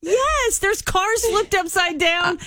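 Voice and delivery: high, incredulous sarcastic retort; mock exasperation. Host answering a driver's silly wreck question, mock exasperated. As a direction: throw the silly question back, pitched high.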